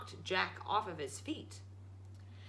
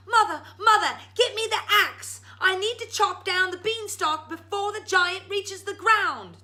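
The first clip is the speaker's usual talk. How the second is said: high, loud urgent cry; urgent shout; 12 semitones higher, 11 dB louder than usual. Acted. Jack shouting down to his mother, urgent. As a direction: call for the axe in a panic.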